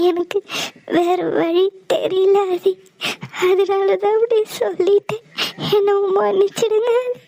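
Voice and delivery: tearful plea, voice rising as she breaks; crying apology. Acted. Thiya tearfully asks Aadhi for forgiveness, crying. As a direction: beg forgiveness through sobs.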